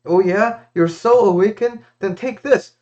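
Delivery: high, loud sarcastic taunt before the strike; mocking king. King to sage before cutting his arm, loud high mocking taunt. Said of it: "And then the king was like".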